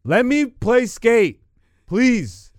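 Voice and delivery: loud, high, excited begging; excited pleading. Real, not acted. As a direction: beg for the game with full hype. Excited pleading about the game.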